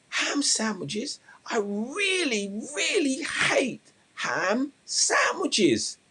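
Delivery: high, loud, slow theatrical outburst; hammed-up exasperated shout; shouted complaint. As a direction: wail it out, stretching every word. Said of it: "he shouts out".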